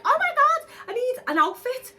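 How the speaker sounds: high, loud mock panic squeal. Retelling her outfit panic, high mock squeal. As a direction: squeal it like a sudden crisis.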